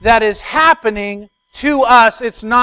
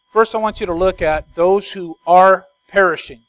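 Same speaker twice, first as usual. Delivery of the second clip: ordinary talk, nothing marked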